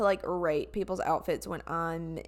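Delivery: ordinary talk, nothing marked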